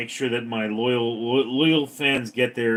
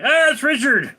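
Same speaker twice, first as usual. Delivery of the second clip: high excited shout, louder; 13 semitones higher, 8 dB louder than usual. Retold or imitated. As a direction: cry out in recognition. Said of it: "they're like".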